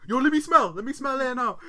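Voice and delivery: very loud, high, demanding shout; mock man-voiced child. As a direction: imitate a bossy boy with a strong man's accent. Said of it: "he's got the strongest man accent".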